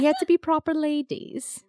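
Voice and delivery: high, put-on British lady; British accent. Recalling the game's proper ladies, British accent. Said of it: "talk in a British accent".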